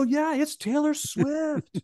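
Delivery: very high, drawn-out squeal; hammed-up giddy announcement; excited confirmation. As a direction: stretch the name slowly up high. Acted confirmation of the reveal.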